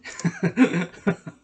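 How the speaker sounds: loud amused laugh. Real, not acted. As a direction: burst of real laughter. Laughing at gated community rappers.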